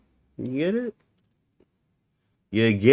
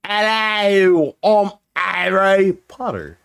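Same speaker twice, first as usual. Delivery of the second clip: hammed-up British accent, higher and drawn out; 4 semitones higher, much slower than usual. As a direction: put on a mock British accent. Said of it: "randomly got a British accent".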